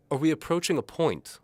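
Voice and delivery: high, loud haughty noble; impatient nobleman. A narrator's reading. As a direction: cut in with impatience.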